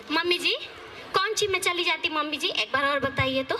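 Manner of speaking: usual speaking voice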